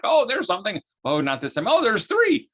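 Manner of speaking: high eager mock excitement; excited checking email. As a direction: jump at each new email like a kid. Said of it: "I'm like".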